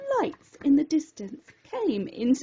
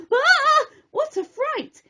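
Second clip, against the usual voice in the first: high, sudden cry of alarm; high startled yelp of fright; 12 semitones higher, 4 dB louder than usual. Acted. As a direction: jump out of your skin. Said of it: "He jumped in alarm".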